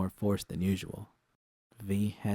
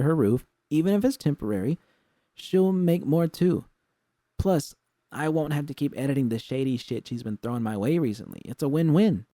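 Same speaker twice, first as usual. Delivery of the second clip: Jude's voice, higher and hopeful; eager pitch; 7 semitones higher, 5 dB louder than usual. A narrator's reading. Jude pitches the studio plan to V, eager. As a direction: sell the plan with rising eagerness.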